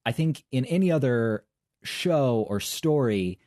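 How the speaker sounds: ordinary talk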